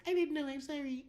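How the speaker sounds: tiny, very high-pitched cute voice; adorable cartoon character. Teammate apologizing after being yelled at, adorable cartoon voice. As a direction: imitate a sweet little cartoon apologizing. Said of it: "this adorable cartoon character that just responds in the most adorable way".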